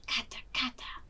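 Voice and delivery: soft whispered clacks; clapping sticks. A narrator's reading. Abukar joins with clapping sticks, whispered clacks. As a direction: tick it out like sticks, hushed.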